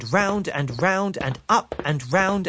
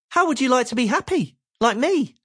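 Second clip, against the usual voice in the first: high, quick, bright offer; cheerful Mister Happy; 10 semitones higher than usual. Acted. Mister Happy eagerly offers happiness to Mister Miserable. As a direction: tempt him with cheer.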